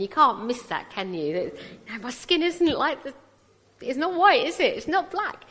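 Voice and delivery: ordinary delivery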